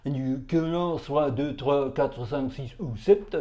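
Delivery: slow French with the chin stuck out; exaggeratedly proper French accent, drawn out; demonstrated French accent. Shown as a demonstration. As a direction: jut the chin and speak careful French. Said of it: "you stick your chin out like this".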